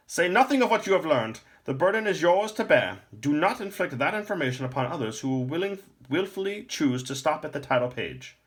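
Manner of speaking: put-on high, loud commanding old man; an old lore master giving orders; stern old man.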